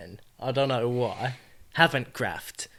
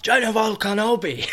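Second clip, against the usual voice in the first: higher, louder growl of General Kenobi; mock General Kenobi shout; 8 semitones higher, 5 dB louder than usual. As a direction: call out the name like a cartoon villain. Star Wars quote riffing.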